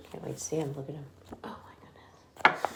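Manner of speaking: soft whispered amazement; whispered aside. Real, not acted. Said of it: "reader's whispered aside".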